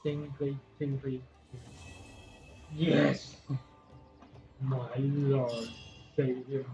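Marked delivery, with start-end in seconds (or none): none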